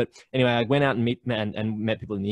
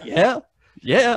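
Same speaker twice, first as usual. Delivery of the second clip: high, loud rising yeahs; eager yeah; 12 semitones higher, 7 dB louder than usual. Retold or imitated. Agreeing eagerly with the bartender, high and loud.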